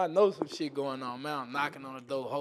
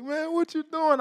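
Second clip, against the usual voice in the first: mock shout; 12 semitones higher, 5 dB louder than usual. Girlfriend caught, shouts at him.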